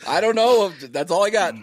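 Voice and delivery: high, loud, whiny complaint; Ray Romano impression. Friend repeating his Ray Romano attempt. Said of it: "show him who Ray Romano is".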